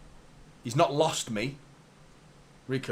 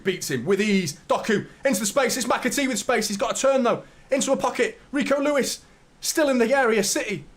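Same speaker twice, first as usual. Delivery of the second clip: raised, quick match commentary; live play-by-play; 10 semitones higher, 5 dB louder than usual. Acted. Streamer calling the City attack as it happens, commentary voice.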